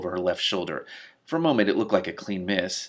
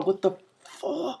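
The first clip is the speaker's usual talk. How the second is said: startled gasp; 9 semitones higher, 5 dB quieter, slower than usual. Real, not acted. Reader startled by the scene.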